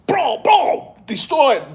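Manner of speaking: high excited cry of "Bro, bro"; hyped. Real, not acted. Telling Rachel about Gambit's fight, hyped high cry. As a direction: lose it over the fight.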